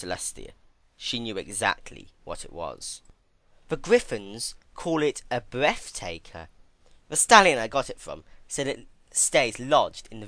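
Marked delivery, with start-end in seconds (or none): none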